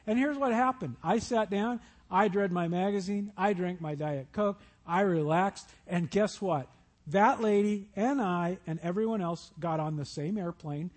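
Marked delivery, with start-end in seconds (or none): none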